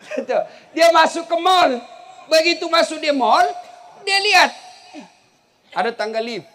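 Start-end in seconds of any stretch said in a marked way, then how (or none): 0.8-4.5 excited storytelling shout. Real, not acted. Excited punchline buildup.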